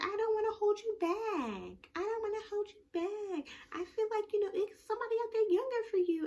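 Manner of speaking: high-pitched mock pleading; retold pleading. She tells her man she won't hold him back, mock pleading.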